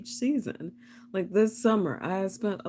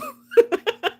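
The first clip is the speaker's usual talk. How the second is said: high laugh; 8 dB louder than usual. Real, not acted. Laughing at own impression.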